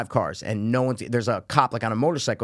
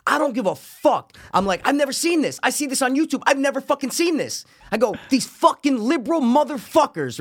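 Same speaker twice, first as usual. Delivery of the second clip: ranting; 11 semitones higher than usual. Retold or imitated.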